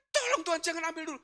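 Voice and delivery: high, rushed plea; imitated frantic cry. Wife crying to God for husband, imitated frantic plea. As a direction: beg urgently, words tumbling out. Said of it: "Dia teriak memang begitu".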